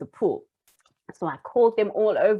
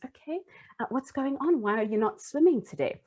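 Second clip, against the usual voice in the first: gentle coaxing; 6 semitones higher, 5 dB quieter than usual. Retold or imitated. Teacher crouching to ask child gently.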